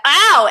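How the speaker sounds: high, loud "ow"; sudden mock cry of pain; imitated pain yelp. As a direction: yelp like a hurt friend. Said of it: "my friend went".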